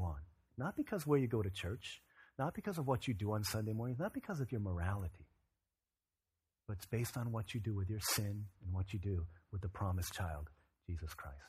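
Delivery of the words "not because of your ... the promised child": ordinary delivery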